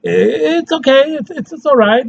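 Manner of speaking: high, hesitant, noncommittal; lukewarm verdict. Acted. Lukewarm verdict before the praise.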